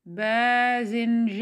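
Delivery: bazinjan read very slowly, each syllable clear. Shown as a demonstration. Teacher slowly reads bazinjan to the class. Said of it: "j, alif et noun, ce qui donne".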